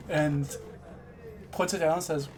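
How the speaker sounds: normal talking voice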